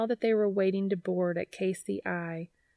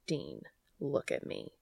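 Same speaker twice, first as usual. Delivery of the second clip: low, softer firm command; 8 semitones lower, 7 dB quieter than usual. A narrator's reading. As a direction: take control calmly. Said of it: "same tone of command".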